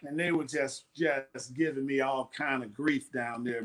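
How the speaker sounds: ordinary talk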